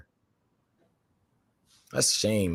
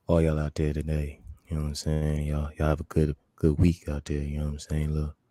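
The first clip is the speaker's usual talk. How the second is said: deep smooth radio-lover voice sending good wishes; 7 semitones lower, 5 dB quieter than usual. Pretend.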